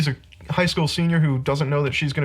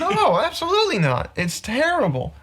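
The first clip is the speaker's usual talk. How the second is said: high-pitched emphatic refusal; exasperated rant; 12 semitones higher than usual. Real, not acted. Podcaster rejecting any comparison with Titanic, exasperated. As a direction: shoot it down in exasperation, pitch leaping up. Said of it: "[name]'s red hot rage".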